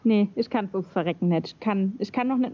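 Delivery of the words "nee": ordinary talk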